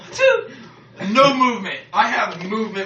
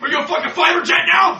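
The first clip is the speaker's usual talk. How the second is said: exasperated shout; 7 semitones higher, faster than usual. Real, not acted. Exasperated rant at sneezer.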